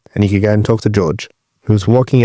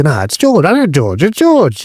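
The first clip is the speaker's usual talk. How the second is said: high, offhand "it's George", climbing higher; mock dismissive; 5 semitones higher, slower than usual. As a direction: shrug him off as an old mate. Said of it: "But, like".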